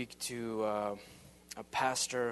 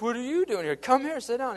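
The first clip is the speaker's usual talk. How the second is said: high, loud, playful echo of the question; excited echo; 12 semitones higher, 9 dB louder than usual. Retold or imitated. Narrator echoing the youth pastor's surprise back at him, high and loud. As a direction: bounce it right back and wave him over.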